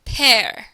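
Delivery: "Père" said firmly with a downward glide; falling tone. Shown as a demonstration. Teacher repeats the example for the class, falling tone. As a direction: drop the tone through "Père". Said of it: "You hear how the tone of your voice goes down".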